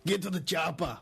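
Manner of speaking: hammed-up Austrian tough-guy yell; Schwarzenegger. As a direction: bellow it as an accented action-movie star. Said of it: "want me to do my Schwarzenegger".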